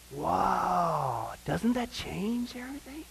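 hushed awed whisper. Real, not acted. Preacher hushing his congregation in wonder, whispering. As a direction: breathe it out in awe. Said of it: "awed aside".

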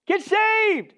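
shouting please, urgent and raw; plain urgent plea. Shown as a demonstration. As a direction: cry it out to a lost soul. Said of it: "It's okay to say".

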